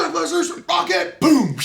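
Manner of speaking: high, loud warning call ending in a big boom; reenacted battlefield call. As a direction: shout the launch warning, then boom. Reenacts calling out rocket launch.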